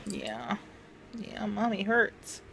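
soft soothing. Real, not acted. Soothing the dog softly.

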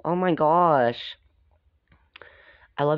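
ordinary delivery, nothing marked

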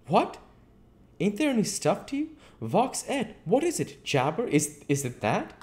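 high-pitched, puzzled tramp voice; incredulous tramp. Acted. Tramp quizzes the invisible man, high and incredulous.

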